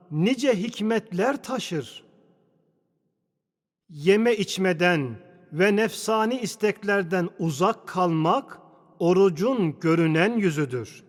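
ordinary talk

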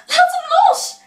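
very high, loud scream; Grietje shrieking. Acted. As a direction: shriek for your brother. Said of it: "gilde Grietje".

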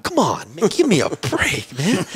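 high, drawn-out comic plea; mock exasperation. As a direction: beg for mercy, hammed up. Mock plea to crying child.

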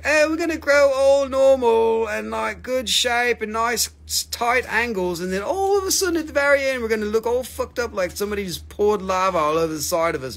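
mock voice of a crystal, high at first then falling; playful talking-rock voice. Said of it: "it's just like".

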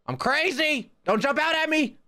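high-pitched, panicky bluster; frantic warning yell. Real, not acted. Player warning the ghost not to jump out, high frantic yell. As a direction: yell it high to scare a monster off. Warns the ghost off while fleeing.

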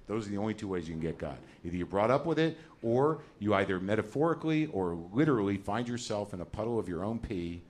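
normal talking voice

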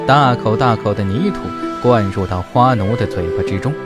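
usual speaking voice

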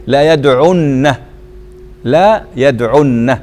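slow يدعن with short "u" and held "nn"; doubled nun. Shown as a demonstration. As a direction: sound out لا يدعن as "la yadʕunna". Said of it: "فحذفنا واو الفاعل".

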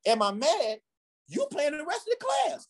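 high, astonished retort; imitated incredulous teacher. Teacher answering boy who fears he is mad, high incredulous retort. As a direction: climb high in mock disbelief.

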